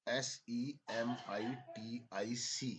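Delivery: each letter spelled out slowly; slow spelling dictation. Shown as a demonstration. Teacher finally spelling Semitic slowly for students. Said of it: "spelling बोलूंगा".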